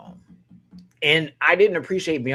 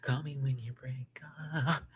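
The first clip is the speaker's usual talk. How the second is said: whisper; 11 dB quieter than usual. Acted. Whispering the song title.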